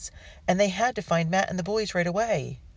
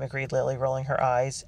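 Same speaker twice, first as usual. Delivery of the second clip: plain reading voice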